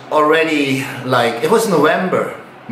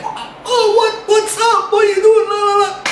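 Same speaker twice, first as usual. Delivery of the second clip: much higher, shouting boss caught off guard; shocked boss. Retold or imitated. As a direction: yell it like a stunned gym owner. Boss reacts to him quitting.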